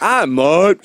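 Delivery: Orson Welles. Acted. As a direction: boom it like a hammy actor. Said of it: "fucking Orson Welles".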